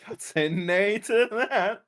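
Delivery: slow, amused mock repeat; laughing echo. Echoing the put-on line while laughing.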